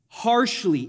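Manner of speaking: ordinary delivery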